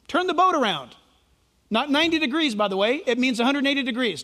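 usual speaking voice

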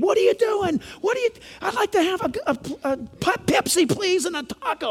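a lightly voiced, passionate diner; lightly imitating an animated Middle Eastern diner; excited chatter. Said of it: "They're talking like this".

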